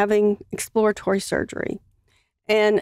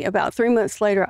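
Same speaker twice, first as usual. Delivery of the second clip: normal talking voice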